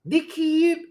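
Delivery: very high Aussie twang; attempted Australian accent. Shown as a demonstration. Streamer retrying after criticism, high Australian accent. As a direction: squeeze an Australian twang into one word. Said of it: "They add that Southern tang to it".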